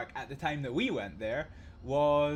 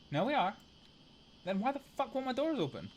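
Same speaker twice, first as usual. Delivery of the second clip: high, quick, baffled complaint; exasperated; 8 semitones higher, faster than usual. Real, not acted. Driver baffled by his stuck train doors, exasperated. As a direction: demand an answer, pitch shooting up.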